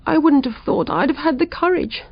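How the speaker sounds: shaken June. A narrator's reading. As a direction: voice June still trembling after the burglar. June voiced higher.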